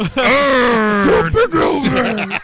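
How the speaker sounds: high slow shouted puppet voice; Super Grover. Acted. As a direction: belt it out like a Muppet hero. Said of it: "I never heard Grover say".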